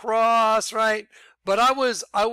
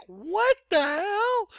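high, slow, stretched-out what the hell; shocked disbelief; much slower than usual. Retold or imitated. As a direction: let it drag out in stunned disbelief. Shocked at Google Earth image.

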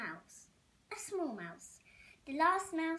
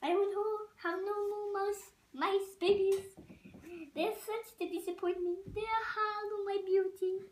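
higher vain French complaint, half sung at the end; French accent; 7 semitones higher, 3 dB louder than usual. Acted. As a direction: lament for her beauty in a French accent. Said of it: "A, a French accent".

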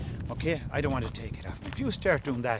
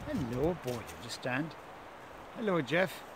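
not overexcited; 8 dB quieter than usual. Shown as a demonstration. Trainer greeting his waiting dog, quiet and unexcited. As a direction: greet the dog softly, without shouting. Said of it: "I'm not shouting".

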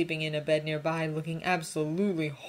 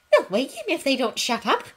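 higher, louder indignant hiss; 9 semitones higher, 7 dB louder than usual. A narrator's reading. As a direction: hiss it out, outraged. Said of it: "hissed indignantly".

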